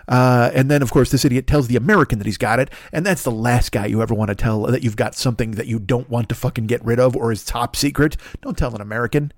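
ordinary delivery

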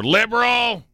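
high-pitched heckler's yell; mock yell. Stranger heckling a woman over her mask, mock yell. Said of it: "Guy yells at her".

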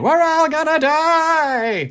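high, slow mock panic scream; panicked scream. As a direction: wail it like the world is ending. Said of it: "run around the house screaming".

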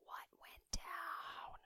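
breathless unvoiced whisper, slow; whispered gossip. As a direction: hush it like juicy gossip. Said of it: "in a different voice".

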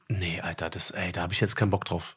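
low, soft grumble to himself; muttered inner thought. Retold or imitated. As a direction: mutter it under your breath, fed up.

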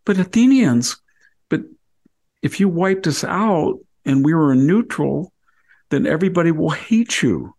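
high, urgent plea for mercy; desperate pleading. Retold or imitated. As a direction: beg the stronger side to see reason. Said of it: "And they say".

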